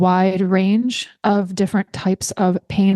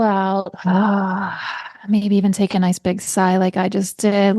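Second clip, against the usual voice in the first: guided breathing. Acted. Leads a breathing exercise.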